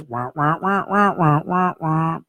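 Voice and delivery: muffled "wah wah" like a trumpet; trumpet talk. Retold or imitated. Counter man answers in dream gibberish, trumpet talk. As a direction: imitate faceless speech as droning wah-wahs. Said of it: "he's talking in trumpet".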